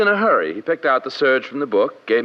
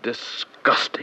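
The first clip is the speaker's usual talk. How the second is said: disgusted murmur; 4 dB quieter, much slower than usual. Acted. Tailor reacts to the story.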